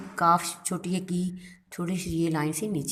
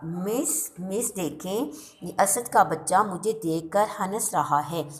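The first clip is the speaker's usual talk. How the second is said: normal talking voice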